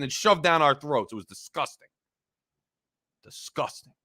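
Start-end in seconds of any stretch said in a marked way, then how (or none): 1.1-1.9 low, soft, drained disgust; quiet disgust. Real, not acted. Host sighing over the Baltimore blowout, quietly. 3.2-3.9 breathy whisper of disgust. Real, not acted. Host repeating his verdict on the loss, whispered.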